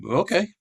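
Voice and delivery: OKAY lifted high into a question; retold doubtful agreement. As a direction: say "Okay" with a doubtful upward slide. Retold unsure agreement to friend's plan.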